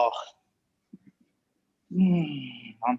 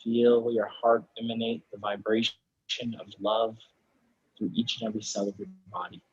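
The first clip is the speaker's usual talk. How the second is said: soft, unhurried meditation voice with long pauses; guided meditation; 6 dB quieter than usual. Real, not acted. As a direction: ease them gently into final rest, softly. Closing guided relaxation in yoga class.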